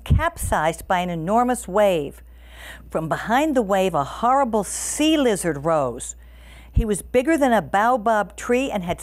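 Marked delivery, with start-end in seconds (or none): none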